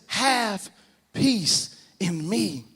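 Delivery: high, loud, drawn-out proclamation; preaching at full force. Acted. Preacher voicing God's promise to the church, full-force preaching. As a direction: swell from a slow opening into ringing exhortation.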